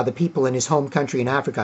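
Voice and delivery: ordinary talk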